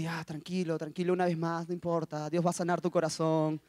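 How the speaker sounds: low, soft murmured reassurance; self-soothing. Acted. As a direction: talk yourself down quietly. Acts out calming himself while trembling.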